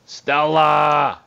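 mock yell of Stella; hammed-up street yell. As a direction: call out like the guy outside the house.

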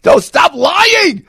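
high, stretched-out sarcastic shout; mock shout. Real, not acted. Host mocking the president on morning radio, shouting. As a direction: yell it slow and sarcastic.

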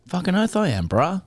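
lightly put-on slangy bloke voice; put-on bro talk. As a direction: answer like a put-on cricket bro. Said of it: "That's not how you talk".

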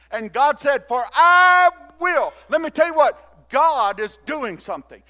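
loud, driving declaration; full-force preaching. Real, not acted. Preacher tells congregation why not to fear, forceful and loud. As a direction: hammer each point home to the crowd.

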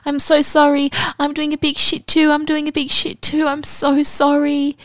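high, pleading mock apology; high apologetic chef. Chef apologizing to his date in a high pleading voice. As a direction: squeak out a desperate sorry. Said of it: "He's like".

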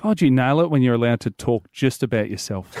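usual speaking voice

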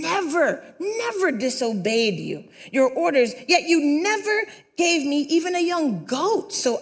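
indignant protest climbing high; angry older brother. Acted. Older brother protests to his father, angrily indignant. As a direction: build resentment to a high outburst. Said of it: "the older brother became angry".

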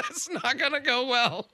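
high-pitched, through laughter; laughing. Real, not acted. Host realising joke misfires, high laughing delivery. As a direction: crack up while saying it.

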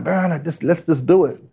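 imitating his young eager self; eager young fella. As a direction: egg the old man on, pitch up high. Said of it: "I was that young fella, you know, going".

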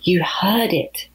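slow, stressed, knowing; slow knowing emphasis. Acted. Malaki insisting to Opie, slow and knowing. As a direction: lean on it slowly, smiling.

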